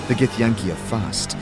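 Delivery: ordinary delivery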